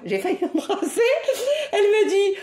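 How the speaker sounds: laughing through the words, pitch going up; can't keep from giggling. Real, not acted. Laughing at her own story.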